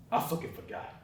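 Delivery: breathed out with no voice; whispered confession. Acted. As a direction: whisper it in shame. Confessing forgotten birthday.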